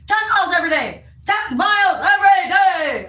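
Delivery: high, loud drill-sergeant bark; Italian guy shouting. Retold or imitated. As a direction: bellow it like a pushy Italian trainer. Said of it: "He's Italian, so he's like".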